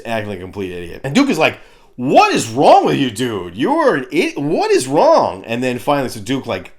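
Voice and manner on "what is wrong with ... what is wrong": loud, high-swooping mock fury; exasperated telling-off. Duke berating Falcon for acting like an idiot, mock exasperated. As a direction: berate him in hammed-up disbelief.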